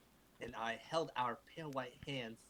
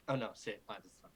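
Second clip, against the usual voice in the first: ordinary talk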